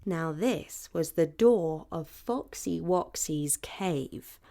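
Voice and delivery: usual speaking voice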